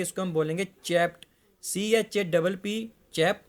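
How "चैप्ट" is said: "चैप्ट" ending in a clear t sound, no d; ed ending voiced as t in "चैप्ट"; chapped said as chapt. Shown as a demonstration. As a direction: say "चैप्ट" with a crisp final t. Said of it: "E डी वाला डी का sound नहीं बोलेंगे".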